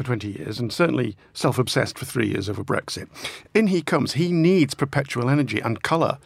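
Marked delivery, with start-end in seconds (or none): none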